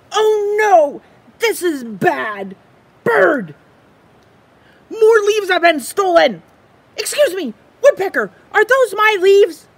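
high, fast, frantic little squirrel voice; excitable squirrel. Acted. Squirrel frets over his missing leaf, high and anxious. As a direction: play the squirrel, jumpy and loud. Squirrel panics, calls Bird.

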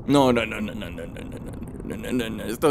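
very low, soft string of no's; hushed, low, sinking murmur; dismayed muttering. Real, not acted. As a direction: mutter it down almost to nothing, slowing. Dismayed muttering after the draw.

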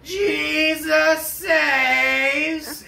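long drawn-out high scream of Jesus saves; slow stretched street-preacher yell; man screaming. Retold or imitated. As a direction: belt it out like a street preacher from behind. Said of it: "we just hear a man scream".

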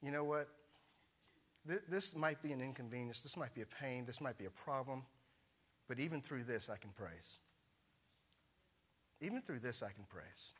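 soft, low, settling into praise; quiet resolve. Retold or imitated. His own thought turning to praise, soft and resolute. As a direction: drop to near a hush at the end. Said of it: "there was a shift".

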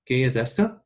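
"esto" with a clear s, Latin American style; ¿Qué es esto? said with seseo; Latin American Spanish. Shown as a demonstration. Teacher models the Latin American version, Latin American Spanish accent. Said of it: "in, um, Latin American Spanish".

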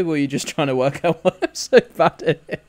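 chuckling through the words; laughing at himself; laughing while talking. Real, not acted. Teasing self, laughing through.

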